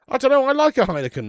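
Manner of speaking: loud mock-indignant defence. Real, not acted. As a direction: defend the beer with a loud protest. Defending beer choice.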